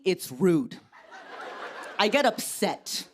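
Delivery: normal talking voice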